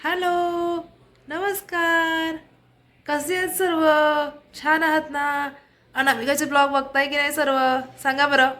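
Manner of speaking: high, drawn-out sing-song hello; sing-song greeting. Real, not acted. Vlogger greets her viewers, high and sing-song. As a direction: greet them in a high, lilting voice.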